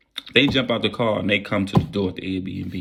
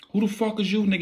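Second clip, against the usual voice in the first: high mock yell; 11 semitones higher, faster than usual. Armed intruder yelling.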